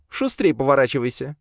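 high-pitched impatient command; sharp order. A narrator's reading. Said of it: "резко ответила Лея".

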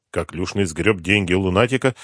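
plain reading voice

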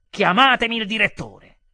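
very loud, high command; furious demand. Acted. Customer demanding the manager, furious and loud.

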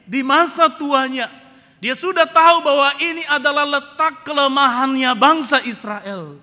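loud, high, declamatory sermon; forceful preaching. Real, not acted. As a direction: proclaim it to the congregation at full force. (berkhotbah lantang dan berapi-api)

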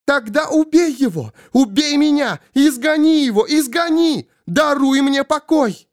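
very high-pitched desperate cries; shrieking anguished plea; frantic begging. Acted. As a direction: cry out each demand at the top of the range. Svol begs to be killed.

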